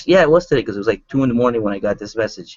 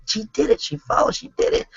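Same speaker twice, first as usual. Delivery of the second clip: thrilled; 3 dB quieter than usual. Real, not acted. Bragging to the audience about a recruit, thrilled. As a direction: pitch shooting up, breathless with pride. Proud of her success.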